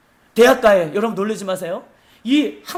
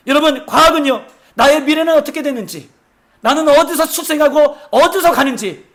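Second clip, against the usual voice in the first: raised, driving sermon voice, higher and louder; fiery preaching; 4 semitones higher, 5 dB louder than usual. Acted. Pastor warning the church about the last days, fiery preaching. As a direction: preach it out to the congregation.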